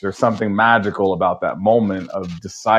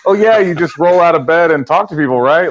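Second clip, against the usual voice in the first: higher, louder, airily dismissive mimicry; mock breezy; 8 semitones higher, 5 dB louder than usual. Guest imitating people who think podcasting is easy, mock breezy. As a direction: toss it off as if it were effortless. Mocking a breezy naive assumption.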